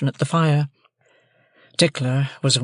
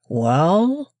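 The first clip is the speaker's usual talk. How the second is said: slow, higher, simple blacksmith; Joe's slow country voice; 4 semitones higher than usual. A narrator's reading. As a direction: Joe tells Pip, slow country drawl. Joe answers Pip.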